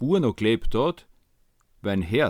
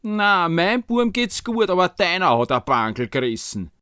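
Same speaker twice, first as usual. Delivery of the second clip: other woman's voice; 6 semitones higher than usual. Acted. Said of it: "die andere Frau hat gesagt".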